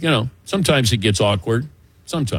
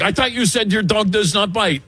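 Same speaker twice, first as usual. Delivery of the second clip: mock French inspector; 7 semitones higher than usual. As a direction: shout it like a bumbling French detective. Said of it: "the scene in Pink Panther".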